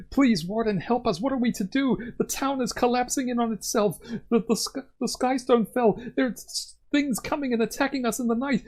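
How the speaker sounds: put-on high, begging young villager; pleading villagers. Young townsfolk begging the warden for help, high and pleading. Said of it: "they'll be saying".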